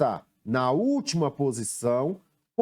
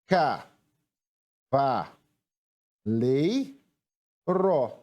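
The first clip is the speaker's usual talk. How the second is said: "Ca, va, lei, ro" with long pauses between; separated syllables, one at a time; much slower than usual. Shown as a demonstration. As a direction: dictate each syllable on its own. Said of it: "Vamos separar agora em sílabas".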